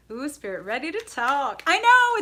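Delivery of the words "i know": much higher and louder burst; excited squeal. Real, not acted. Reader answers chat about the hangout, excited squeal. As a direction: squeal with delight.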